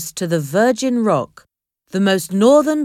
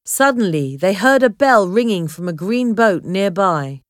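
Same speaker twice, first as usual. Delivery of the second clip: usual speaking voice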